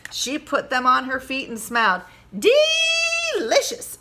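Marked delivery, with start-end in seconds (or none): 2.3-4.0 very high, slow, drawn-out delight. Acted. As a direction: savor the word. Trainer delights in boots.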